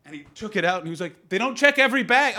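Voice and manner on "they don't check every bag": high, loud mock voice of a careless friend; blasé friend. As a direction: toss it off like a reckless buddy. Quoting casual drug smuggler friend.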